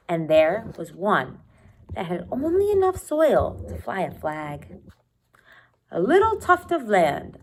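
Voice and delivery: usual speaking voice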